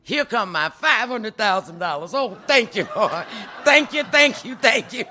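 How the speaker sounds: loud, high wail of joy; hammed-up sobbing thanks, half laughing; mock crying. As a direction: cry out gratefully in a high shout. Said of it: "broke down and started crying".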